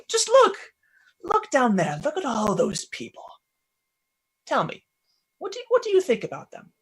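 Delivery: high-pitched urging mentor; Quell. Acted. Mentor urging boy to look down at people, insistent. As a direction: start high and insistent, then ease off.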